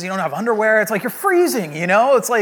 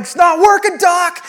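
high, loud panicked cry; freaking-out shout; 11 semitones higher, 8 dB louder, slower than usual. Retold or imitated. Freaking-out patient to doctor, panicked shout. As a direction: yell desperately for the doctor. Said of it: "I'm freaking out".

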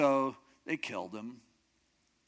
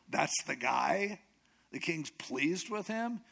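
slow, disbelieving questions; stretched-out questions lifting higher; incredulous wife; 5 semitones higher, slower than usual. Retold or imitated. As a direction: draw it out in disbelief. Wife's alarmed reaction, acted.

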